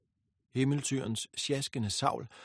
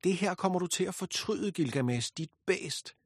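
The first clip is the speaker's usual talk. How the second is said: higher, bitter vow of revenge; grieving fury; 6 semitones higher than usual. A narrator's reading. Ishtar vowing revenge on Gilgamesh, high and bitter. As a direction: spit the insult through grief. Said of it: "af sorg og vrede".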